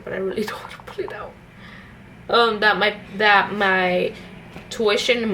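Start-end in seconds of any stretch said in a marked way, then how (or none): 0.0-1.3 hushed, quick aside, half laughing; embarrassed whisper. Real, not acted. Doesn't want to show papers.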